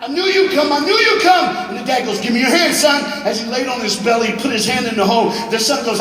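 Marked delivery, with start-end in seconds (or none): 0.0-1.5 very high, loud joyful shout; child shouting up in relief; yell back. A narrator's reading. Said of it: "hear his son yell back". 2.2-3.0 loud, higher urgent plea; father urging his trapped son; urgent call. A narrator's reading. Father reaching into the hole.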